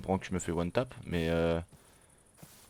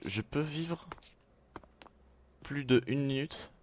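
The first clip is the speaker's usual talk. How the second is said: supplication plaintive; 7 semitones higher, slower than usual. Real, not acted. Supplie les autres joueurs.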